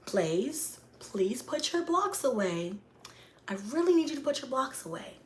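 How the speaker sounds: high, mock-sweet pleading; talking sweet. Mom asking her son to put blocks away, talking sweet. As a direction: imitate an overly polite, gentle request. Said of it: "talking sweet don't work".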